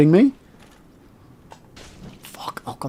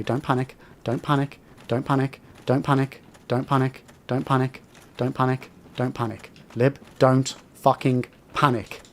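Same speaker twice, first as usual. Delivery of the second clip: forced calm before panic; 3 semitones lower, 7 dB quieter than usual. Real, not acted. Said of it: "have you considered panicking? Actively panicking".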